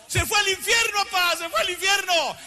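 high, urgent cry of alarm; retold outburst, pitched high; anguished outcry. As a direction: cry it out to Dad, high and pressing. Acted outburst over friend's death.

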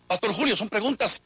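ordinary talk

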